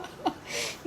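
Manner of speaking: soft unvoiced laugh; quiet huff of laughter; breathy chuckle. Real, not acted. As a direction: silent laugh.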